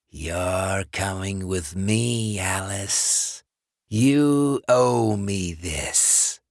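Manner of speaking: slow, breathy rasping whisper of a corpse; undead John. Acted. Dead ex-boyfriend luring Alice, slow rasping whisper. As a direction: hiss it through the door like a dead man.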